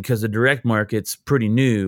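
usual speaking voice

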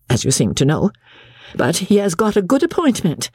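embattled mistress promising payment; pleading mistress. A narrator's reading. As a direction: placate the creditors, voice lifted. Narrator voices Becky pleading.

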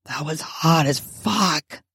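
low, hushed character voice; hushed but carrying; loud whisper. A narrator's reading. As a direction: drop low into a stage whisper. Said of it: "you say in a loud whisper".

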